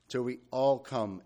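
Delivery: "all" weighted above the words around it; stress on "all". Real, not acted. Preacher rereading the verse to the group, stress on "all". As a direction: hit "all" firmly as the key word. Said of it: "emphasis on the word all".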